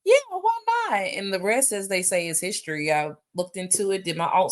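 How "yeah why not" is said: high-pitched, dreamy "huh, yeah, why not"; as if the sky just parted; sudden realization. Retold or imitated. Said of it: "And I was like".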